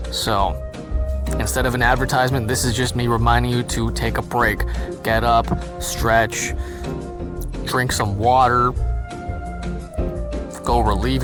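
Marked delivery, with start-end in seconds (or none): none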